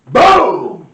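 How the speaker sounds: loud shouted boom; big mock explosion. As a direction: blast the boom out like a firecracker. Imitating firecracker explosions.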